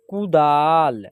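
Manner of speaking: कुदाल with short कु, long दा; drawn-out model reading. Shown as a demonstration. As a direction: hold the आ in कुदाल. Teacher models the blended word.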